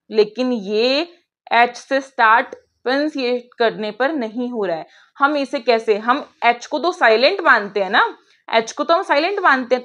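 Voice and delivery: ordinary talk, nothing marked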